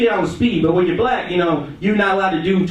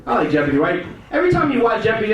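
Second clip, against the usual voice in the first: ordinary talk, nothing marked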